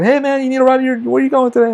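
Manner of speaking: high-pitched, hearty call to a buddy; upbeat acted-out hail; imitated friendly tech. Acts out friendly techs offering ride.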